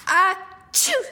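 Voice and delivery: a big high sneeze; sneezing Sue. A narrator's reading. Sue finally sneezing herself. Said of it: "sneezed Sue".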